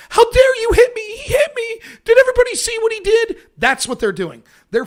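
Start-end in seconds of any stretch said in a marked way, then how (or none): 0.1-3.4 high, loud shrill-free mock outrage; loud imitating girl's indignation; mock indignant girl. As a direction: play the wronged girl, hammed-up. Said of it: "that girl then looks and goes".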